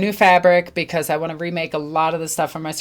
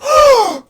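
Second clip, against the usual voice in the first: sharp high loud gasp of delight; clerk's thrilled gasp; excited gasp; 8 dB louder than usual. Retold or imitated. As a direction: gasp like the clerk just spotted something amazing. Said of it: "And he's like".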